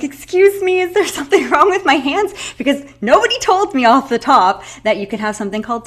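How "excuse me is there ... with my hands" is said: high, loud startled question; shocked, half laughing; alarmed outburst. Retold or imitated. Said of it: "And I'm like".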